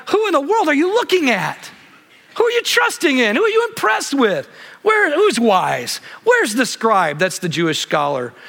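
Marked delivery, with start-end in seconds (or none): 0.1-7.2 Paul's challenge. Retold or imitated. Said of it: "Paul's going like".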